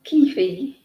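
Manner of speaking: "Keefe" said as KEE-fee; regional coffee pronunciation. Retold or imitated. Streamer attempting Beverly's way of saying coffee, regional pronunciation. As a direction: try "Keefe" with a long ee in both syllables. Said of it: "how do you say coffee?".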